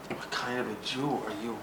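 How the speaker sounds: soft, low self-questioning; hushed wondering. Retold or imitated. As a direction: murmur it to yourself in wonder. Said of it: "Nobody ever asked me that".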